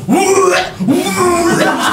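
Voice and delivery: high loud mock gagging; hammed-up retch sounds; imitated retching. Imitating a big man gagging at a fart, mock retching. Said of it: "starts fucking purging".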